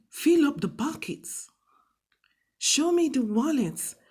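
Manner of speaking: blunt, bossy order with no tag; bossy command. Shown as a demonstration. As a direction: bark it like a bossy boss. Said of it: "It's not too bossy, like".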